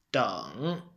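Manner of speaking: "Deng" said low in the dipping third tone. Shown as a demonstration. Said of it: "it's third tone".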